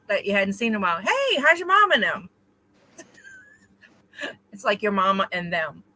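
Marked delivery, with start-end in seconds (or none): 1.0-2.3 much higher, louder sung-out greeting; local greeting. Shown as a demonstration. Greeting an old acquaintance, called out much higher. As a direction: call it out to someone you haven't seen in a while. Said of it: "if you see somebody and go".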